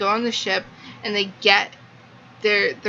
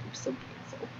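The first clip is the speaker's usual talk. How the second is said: hushed whisper; dreamy swooning whisper; 18 dB quieter, faster than usual. Real, not acted. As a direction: breathe the words out, swooning.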